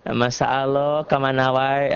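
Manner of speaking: playful Sundanese greeting with long "aaloh"; hammed-up Sundanese lilt; Sukabumi accent. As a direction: mimic a Sukabumi speaker. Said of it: "orang Sukabumi".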